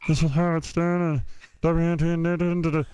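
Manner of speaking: lightly voiced radio announcer, no breath; mock a stiff DJ read without pausing for air. Young DJ imitating a stiff announcer, lightly hammed. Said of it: "You shouldn't breathe".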